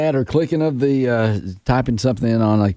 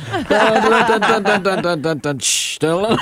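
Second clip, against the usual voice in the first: laughing drum and cymbal sounds; cymbal imitation; 7 semitones higher, slower than usual. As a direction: sing the beats with a giggle. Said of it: "band member with the cymbals".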